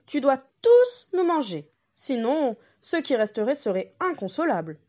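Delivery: goat daring the wolf; mother goat. Acted.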